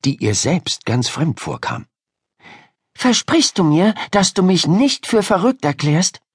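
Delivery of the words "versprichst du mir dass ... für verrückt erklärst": put-on earnest pleading girl. Ida asking Miriam to promise, earnest. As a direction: plead for a promise, pitched high.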